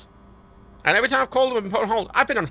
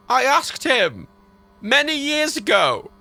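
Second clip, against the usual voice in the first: high, deliberate, pointed reply; strained, drawn-out retort; exasperated answer; 7 semitones higher, 4 dB louder, slower than usual. Retold or imitated. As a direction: space the words out as if to someone dim. Answering a silly bank question.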